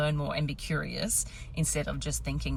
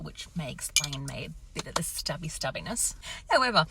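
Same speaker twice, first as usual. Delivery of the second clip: normal talking voice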